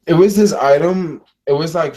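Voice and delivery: rising up in disbelief over the item; excited disbelief. Real, not acted. Complaining about weird credits.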